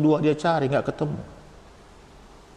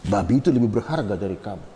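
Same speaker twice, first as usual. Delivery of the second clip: cruel father; faster than usual. Retold or imitated. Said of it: "Dia bilang".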